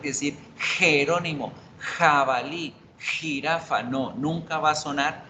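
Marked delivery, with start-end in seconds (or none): 0.6-3.8 "Jerónimo, jabalí, jirafa" with a rasped throaty J; Spanish J. Shown as a demonstration. Teacher contrasts Spanish for learners, "Jerónimo" with a breathy throat J. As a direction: scrape the J at the start of each word. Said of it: "como hacemos en español".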